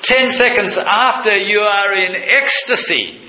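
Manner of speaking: soar up with wonder at the joy; a pastor marvels aloud at Mary's ecstasy; ecstatic. Real, not acted. Preacher's ecstatic climax.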